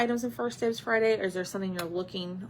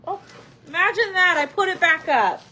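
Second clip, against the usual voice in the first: higher, louder sarcastic imagine that; mock exasperation; 9 semitones higher, 7 dB louder than usual. Real, not acted. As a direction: scold yourself with a playful sigh.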